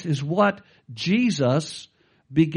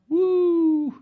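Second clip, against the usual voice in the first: high joyful woo; joyful whoop; 12 semitones higher than usual. Real, not acted. Pastor rejoicing over the risen Christ, high whoop. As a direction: let out a celebratory whoop. Preacher exults.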